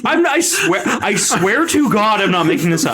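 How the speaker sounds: high, insistent protest. Real, not acted. Cohost doubts him, insistent protest. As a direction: swear it's true.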